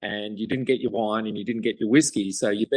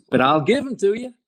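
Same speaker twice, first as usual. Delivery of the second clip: [name]'s bad Tennessee accent; 11 semitones higher than usual. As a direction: put on a bad Appalachian accent. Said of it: "my really bad Tennessee accent".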